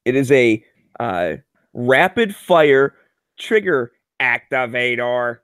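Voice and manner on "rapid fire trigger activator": slowly spelled out, word by word; drawn out like reading a label; slow careful wording. Real, not acted. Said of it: "That's all in quotes".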